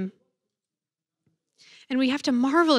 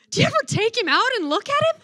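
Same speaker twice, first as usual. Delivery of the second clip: put-on high, fast little-kid blurt; kid forgetting to whisper; 11 semitones higher, 3 dB louder, faster than usual. Four-year-old on the kneeler to sister, forgetting to whisper. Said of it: "forgets to whisper".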